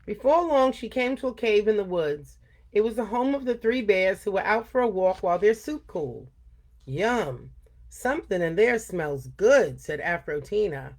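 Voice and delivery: put-on lively read-aloud for kids; storytelling voice for a young audience; story reading. A narrator's reading. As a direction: tell it like story time for children. Reads story to children.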